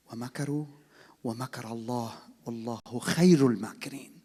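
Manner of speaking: fast, loud chanted recitation; Quran recitation. Real, not acted. Preacher quoting the Quran to the congregation, reciting fast and loud. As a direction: recite the verse in one rushing breath.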